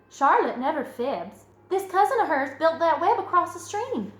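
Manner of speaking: put-on excited, lilting child storyteller; eager girl Fern. Fern telling mother about the fish fight, eager. Fern tells her tale.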